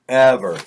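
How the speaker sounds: "Ever" set alone, hit loud; loud, final stress on "Ever"; emphatic repeat. A narrator's reading. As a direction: punch "Ever" as a one-word sentence.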